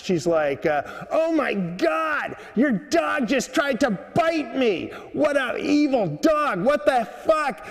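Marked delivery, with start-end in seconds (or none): 1.1-7.6 high, drawn-out outraged shriek in mock fury; imitated screaming woman. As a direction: mimic a woman snapping, pitched way up and stretched out. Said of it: "and the woman snaps".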